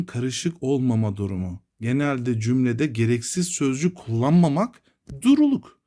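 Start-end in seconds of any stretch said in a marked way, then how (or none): 5.2-5.8 emphatic term. Real, not acted. Teacher stressing key term.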